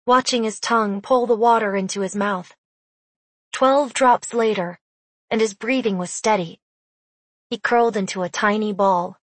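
ordinary delivery

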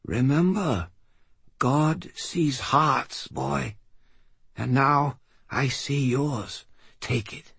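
high, slow, fading voice; dying old man. Acted. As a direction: speak slowly with failing breath. Dying old man's last words.

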